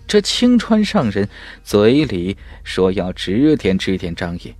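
ordinary delivery, nothing marked

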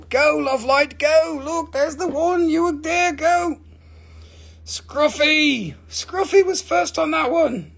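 high-pitched race-caller excitement; excited, high call of the winner; excited cheering. Real, not acted. Calling out the first claimer.

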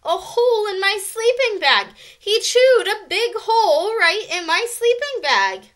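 high, loud outraged shriek. A narrator's reading. Karen outraged over her damaged bedding, shrieking. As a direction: wail it out indignantly. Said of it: "shrieked Karen".

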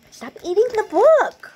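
telling off. Real, not acted. Parent scolding child eating book.

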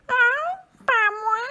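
very high, loud mewing cat. A narrator's reading. Cat refusing to help the hen, high mewing voice. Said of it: "dit le chat".

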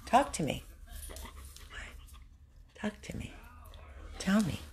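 soft coaxing. Real, not acted. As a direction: gently draw the dog out, almost whispering. Quietly coaxing dog to answer.

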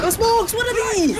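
high, loud panicked yell; panicked shout. Real, not acted. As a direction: shout it in alarm. Panicked callout, enemy wall.

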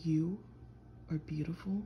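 ordinary talk, nothing marked

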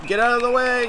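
high urgent call, not yet full shout; urging a player to move; urgent plea. Real, not acted. Yelling at players in game.

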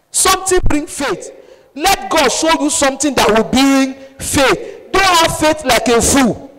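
high, loud, forceful preaching; fiery preaching. Real, not acted. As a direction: drive each word home to the congregation.

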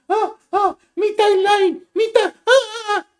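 high, slow, fake-crying whine; mock whining cry. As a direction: mimic her sobbing over her timeline, hammed-up. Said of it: "y es como".

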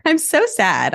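giggly mock sadness; laughing sad. Real, not acted. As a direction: laugh while claiming to be sad.

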